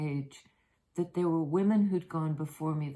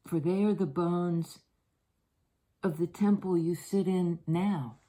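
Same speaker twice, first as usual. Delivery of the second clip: poem reading. Acted. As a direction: read it like a blessing, unhurried. Reads a devotional poem aloud.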